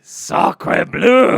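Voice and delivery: loud French chef exclamation; over-the-top French chef cry. Pretend. French exclamation amid Paris talk.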